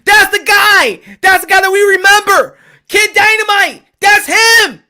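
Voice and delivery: loud, high hyped shout; imitating an excited fan yelling. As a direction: cheer him on from the stands. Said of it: "you'd be like".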